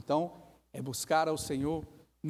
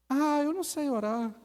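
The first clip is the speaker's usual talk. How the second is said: whiny excuse; 11 semitones higher, 3 dB louder than usual. Acted. Acted excuse.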